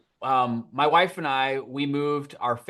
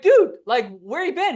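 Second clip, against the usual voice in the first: much higher, louder mock outrage; exasperated call; 9 semitones higher, 5 dB louder than usual. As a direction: let the annoyance burst out, half-joking. Calling exterminator, exasperated.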